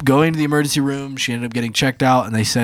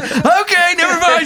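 relieved deflation. Retold or imitated. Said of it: "And I'm like".

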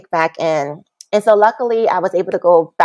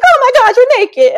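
much higher, louder shocked dad; shocked father; 7 dB louder than usual. Retold or imitated. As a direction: blurt it like a stunned father. Father shocked seeing her naked.

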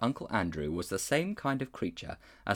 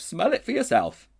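higher, louder insisting bulldog; insistent bulldog; 10 semitones higher, 5 dB louder than usual. A narrator's reading. Bulldog urging the others to sniff. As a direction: demand it like a stubborn dog.

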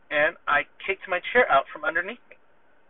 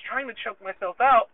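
ordinary talk

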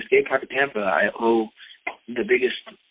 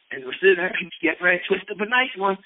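ordinary talk

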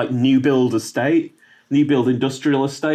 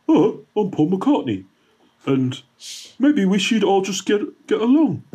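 a higher, sing-song mock Paul; [name] impression; 6 semitones higher than usual. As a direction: lift the voice up for a goofy Paul. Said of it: "[name] goes".

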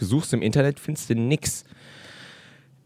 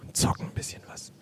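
whispering; 12 dB quieter than usual. Retold or imitated. Retold friends' shoplifting plan.

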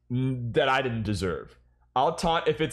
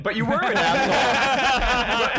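higher, louder, slow and laughing through it; laughing accusation; 10 semitones higher, 4 dB louder, slower than usual. Real, not acted. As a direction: tease him while cracking up. Teasing friend, repeated accusation.

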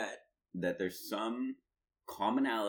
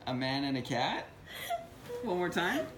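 high-pitched teasing question; hammed-up high, clueless questioning; mock eager bluffer; 12 semitones higher, 3 dB louder than usual. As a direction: ask again with overdone eagerness. Teasing replay of the French bit.

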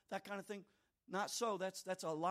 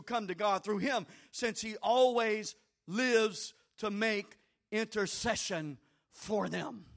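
reading scripture, building louder; scripture read rising to loud preaching; 7 dB louder than usual. Acted. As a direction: read the verse, swelling into a loud preach at the end.

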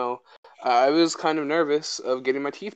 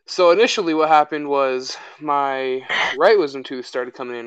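ordinary talk, nothing marked

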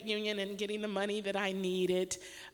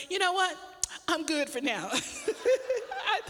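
laughing excitedly; 7 semitones higher than usual. Retold or imitated. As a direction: chuckle it out, overjoyed and relieved. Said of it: "I was laughing as I was getting so excited".

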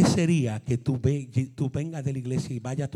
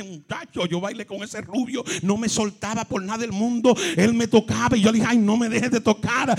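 put-on party girl gushing; 7 semitones higher than usual. A daughter bragging to her mother about dancing, higher. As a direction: brag about the dance.

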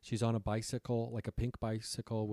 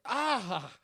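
startled cry; 11 semitones higher than usual. Retold or imitated. Girl crashes into Megan.

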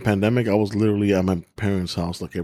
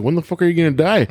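higher, snarky comeback; cheeky retort; 7 semitones higher, 3 dB louder than usual. Retold or imitated. Answering a nagging aunt with a snarky jab. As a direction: jab back with mock innocence. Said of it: "I'm like".

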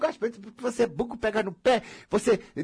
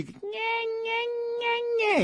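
high mocking nasal whine; whiny nhe nhe; much slower than usual. Mocking the whiny voice she uses on the phone. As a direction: mimic a whiny nagging voice. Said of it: "aquela voz de".

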